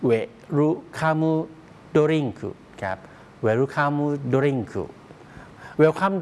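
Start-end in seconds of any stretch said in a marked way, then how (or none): 0.1-2.4 "Welcome Drink" as werukamu dorinku, higher, in separated Japanese syllables; Japanese pronunciation. Shown as a demonstration. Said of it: "การออกเสียงเป็นแบบญี่ปุ่น". 3.5-4.7 "Welcome Drink" joined up as Japanese werukamu dorinku; Japanese pronunciation. Shown as a demonstration. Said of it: "การออกเสียงเป็นแบบญี่ปุ่น".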